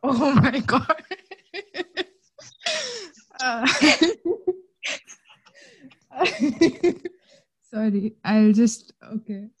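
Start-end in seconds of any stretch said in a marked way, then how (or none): 0.0-6.9 giggling through the words; can't stop laughing. Real, not acted. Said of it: "so I can laugh a bit".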